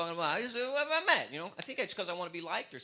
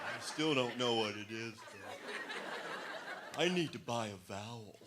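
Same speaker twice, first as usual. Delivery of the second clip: lightly hammed-up dim player; dim contestant; 6 semitones lower, 6 dB quieter than usual. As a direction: drag it out like you're clueless. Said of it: "Turn to Wheel of Fortune".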